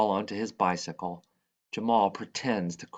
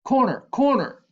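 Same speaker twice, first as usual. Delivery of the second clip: loud, very high excited yell; shout; 9 dB louder than usual. A narrator's reading. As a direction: shout it with all your lungs. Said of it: "and I shout".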